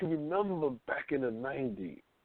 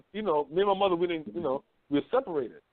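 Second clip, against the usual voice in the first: ordinary talk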